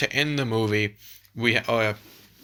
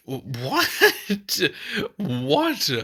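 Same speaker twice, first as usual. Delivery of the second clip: high-pitched, disbelieving what; stunned, squeaky disbelief at what was heard; incredulous; 9 semitones higher, slower than usual. Real, not acted. Baffled reaction to the underwhelming line.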